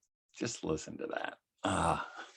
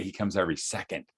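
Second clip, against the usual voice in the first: ordinary talk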